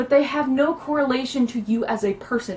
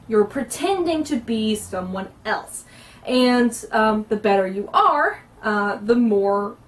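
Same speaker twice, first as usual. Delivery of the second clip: ordinary delivery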